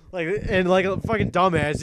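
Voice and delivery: normal talking voice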